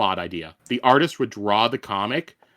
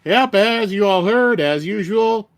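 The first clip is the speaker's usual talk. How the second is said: hyped opening; 10 semitones higher, 5 dB louder, slower than usual. Real, not acted.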